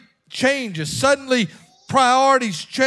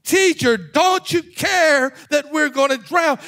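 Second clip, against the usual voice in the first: raised, higher urgent cry; shouting disciples; 3 semitones higher than usual. Acted. Disciples waking Jesus in the storm, shouting. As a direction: call out as if the boat is sinking. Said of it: "The disciples woke him up shouting".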